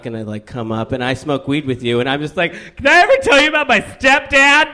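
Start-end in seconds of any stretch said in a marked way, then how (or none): 2.8-4.7 high, loud, overwrought confession; sudden mock-desperate yell; acted outburst. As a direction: burst out with it as a hammed-up emotional overshare. Acts out his stoned oversharing outburst.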